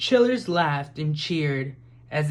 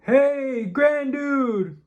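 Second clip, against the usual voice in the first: much higher, louder greeting; shouting hello; 9 semitones higher, 4 dB louder, much slower than usual. A narrator's reading. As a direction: call out from horseback, waving. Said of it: "shouted a man".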